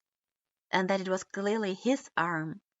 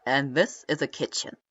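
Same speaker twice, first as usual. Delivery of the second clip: a touch of deep tour-guide pomp; grand flourish; 6 semitones lower, 6 dB louder than usual. A narrator's reading. Kisama finishing a base tour for Itachi, mild flourish. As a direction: announce it like a proud host. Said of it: "ended the tour with a great flourish".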